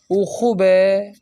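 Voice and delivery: خوب ہے said with است shortened to a short e ending; spoken Tehrani خوب ہے, final e in place of است; colloquial Persian. Shown as a demonstration. Teacher demonstrating colloquial Persian to students, است reduced to e. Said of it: "بے کے نیچے یا ہا لگا دیتے ہیں".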